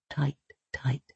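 breathy whisper, fading away; hushed tender whisper. A narrator's reading. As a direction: whisper it as she squeezes tight. Hugging the grave, whispering.

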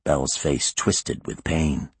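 plain reading voice

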